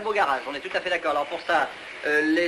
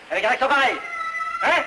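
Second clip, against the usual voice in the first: indignant; 6 semitones higher than usual. Acted. Producer complaining of the accent in his film, indignant. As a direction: throw it at them in disgust.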